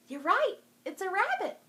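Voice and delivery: loud, high cheer at the reveal; excited reveal. Real, not acted. Librarian revealing the toy rabbit to kids, excited cheer. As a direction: burst out the answer with delight.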